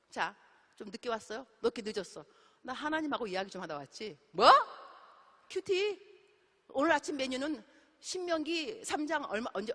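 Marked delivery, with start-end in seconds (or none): 4.3-6.0 high, sharp surprised query; high startled echo; surprised question. Acted. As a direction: pop up in surprise. Friend surprised by quiet time.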